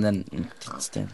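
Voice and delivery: slow, soft, trailing mutter; muttered under breath. Real, not acted. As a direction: grumble it to yourself. Repeats complaint, fading.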